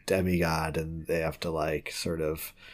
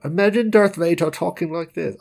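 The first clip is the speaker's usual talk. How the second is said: much higher, louder put-on British accent; terrible British accent; 10 semitones higher, 7 dB louder than usual. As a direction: ham up a bad high-pitched British Darth Vader. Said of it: "that was a terrible British accent".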